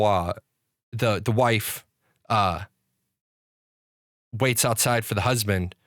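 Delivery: ordinary talk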